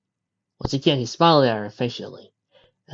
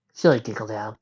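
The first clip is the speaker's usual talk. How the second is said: plain reading voice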